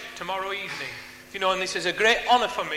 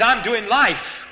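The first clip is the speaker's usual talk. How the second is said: loud, slow, imitated defiance; retold bitter outburst; 2 semitones higher, 7 dB louder, much slower than usual. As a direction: throw it back slowly and loudly. Retold outburst of hardened lifer.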